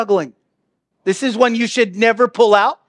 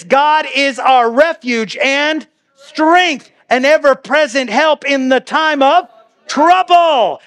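proclaimed scripture; 6 semitones higher, slower than usual. Acted. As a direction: proclaim it slowly, rising with joy. Preacher proclaiming favorite psalm verses.

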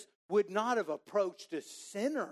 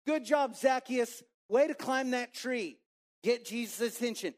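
high, loud mock chant of praise; high crowd cheer, tongue in cheek; imagined cheer; 6 semitones higher, 3 dB louder than usual.

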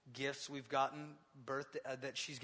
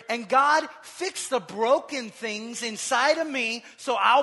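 high, loud, slow pleading prayer; modelling a prayer for the congregation, shouting; shouted prayer; 9 semitones higher, 16 dB louder than usual. Acted. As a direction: cry it out to God. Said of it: "Start praying".

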